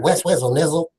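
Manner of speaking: playful rapper slang impression. Host shows off his impression to guest, mock rapper voice. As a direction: imitate a West Coast rapper's izzle talk. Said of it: "that's my [name]".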